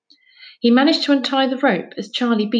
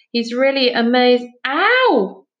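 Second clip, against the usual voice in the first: sudden ow; 4 semitones higher, 5 dB louder, slower than usual. Acted. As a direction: cut off by a sharp yelp, higher. Kicked in the shin mid-word.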